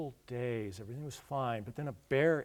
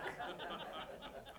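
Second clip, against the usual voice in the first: a quiet breathy chuckle; soft laugh; 10 dB quieter than usual. Real, not acted. Teller laughing at his own made-up entry, a soft chuckle. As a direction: laugh softly at the punchline.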